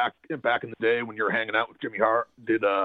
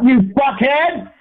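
high, loud, drawn-out scream; angry outburst; 10 dB louder, much slower than usual. Real, not acted. Guest blowing up at the host, angry screamed outburst. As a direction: scream the insult slowly at full volume. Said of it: "say you're sorry for the outburst".

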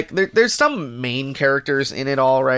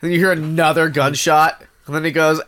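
ordinary delivery

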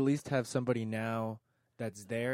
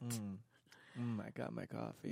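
mumbled imitation; 12 dB quieter than usual. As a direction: mumble it like Bob. Imitating Bob's quiet voice.

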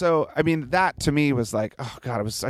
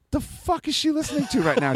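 high-pitched incredulous question; mock-scandalized parent; retold alarmed disbelief; 13 semitones higher than usual. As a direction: shoot up in pitch, appalled at your wife. Dad alarmed, retold question to wife.

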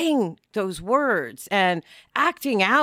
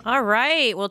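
ordinary talk, nothing marked

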